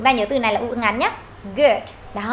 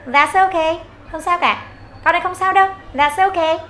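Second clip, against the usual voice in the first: higher, cheerful forgiving tone; happy reassurance; 5 semitones higher than usual. Shown as a demonstration. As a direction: brush off the apology brightly. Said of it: "cái giọng thật là happy".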